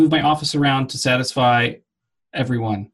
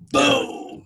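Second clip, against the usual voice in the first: excited exclamation; 13 semitones higher than usual. Real, not acted. Emphatic cap after quoting Oz.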